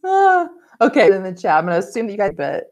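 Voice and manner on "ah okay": laughing. Real, not acted. Still laughing at the glitch.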